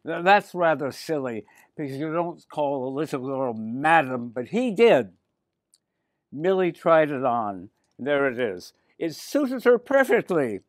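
ordinary delivery, nothing marked

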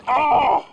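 high sudden yelp of pain; startled yelp. Real, not acted. Angler bitten by the shark, pained yelp.

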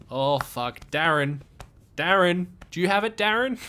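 loud, high Aussie panic, calling out to Darren; frantic Australian bloke shouting for his mate; panicked Australian accent. Pretend. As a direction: yell for your mate in a broad Australian accent. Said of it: "You do a great Australian accent".

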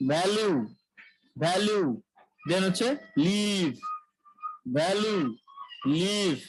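ভ্যালু and লিভ said with a buzzing lip-teeth v; v sound demo. Shown as a demonstration. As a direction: drill ভ্যালু, লিভ slowly with voiced v. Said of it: "ভিতে ভ যদি উচ্চারণ করি". (ঠোঁট-দাঁতে ভ্যালু, লিভ উচ্চারণ)

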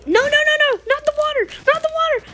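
high-pitched panicked pleading; playful panic. Pretend. Player about to hit the water, playful panic. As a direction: squeal it as the danger nears.

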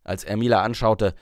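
ordinary delivery

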